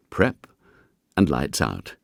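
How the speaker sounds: ordinary delivery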